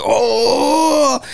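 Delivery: high, amazed oh; astonished. Retold or imitated. Locals amazed at the polyglot, high surprised oh. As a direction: gasp in wonder. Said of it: "they're like".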